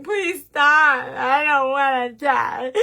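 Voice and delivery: hammed-up loud, slow plea; mock screaming. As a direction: cry out in pretend agony as the forced bristles. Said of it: "they're screaming".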